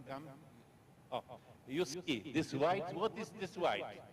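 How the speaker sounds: normal talking voice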